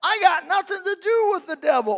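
high-pitched, indignant objector; protesting listener. Acted. Congregant objecting to Brother Bill, high protesting voice. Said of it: "You say".

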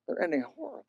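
grieving soft echo of "They're ending horribly"; soft repeat. Shown as a demonstration. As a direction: let "horribly" sink to a near hush. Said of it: "But they're ending horribly".

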